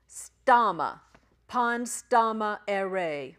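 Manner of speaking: "stama" punched loud, then "pan stama ere" very slowly; phonetic sounding-out. Shown as a demonstration. Teacher models the next Greek words, "pan stama ere" slow and loud. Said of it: "those little phonetical helps".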